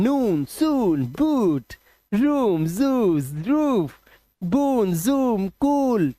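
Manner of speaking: नून, सून, कूल stretched on a long, swooping oo; long vowel sound. Shown as a demonstration. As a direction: draw out every oo in रूम, जूम, कूल. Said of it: "long vowel sound".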